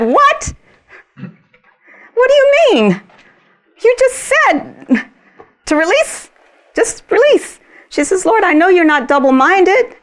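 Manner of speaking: very high, loud indignant questions; shocked disbelief. Retold or imitated. Woman protesting the Lord's no, very high and loud. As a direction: protest like you've been tricked.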